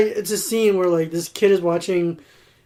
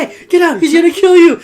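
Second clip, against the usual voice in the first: higher and louder frantic warning; loud, frantic warning at the screen; mock warning shout; 11 semitones higher, 11 dB louder than usual. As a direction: shout at the TV to save them. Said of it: "He's like".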